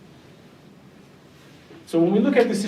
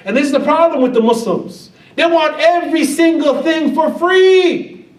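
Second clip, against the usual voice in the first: loud; 9 semitones higher, 7 dB louder than usual. Real, not acted. Preacher scolding the community.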